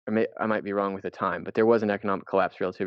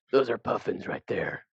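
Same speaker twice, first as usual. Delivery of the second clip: mock nature guy; 2 semitones higher than usual. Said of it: "like, nature guy".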